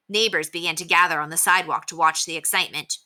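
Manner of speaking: ordinary delivery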